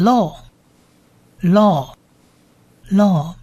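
law as one long "lo", spaced out; pronunciation demo. Shown as a demonstration. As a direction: say law slowly with a long o, pausing between repeats. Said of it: "所以这个念".

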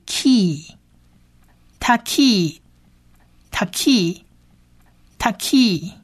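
"key，taki，taki，taki" slowly, k plus long ee; syllable-by-syllable pronunciation. Shown as a demonstration. Teacher blends k and long ee for learners, slow pronunciation model. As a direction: sound out "taki" with a drawn-out final ee. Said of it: "所以是可意".

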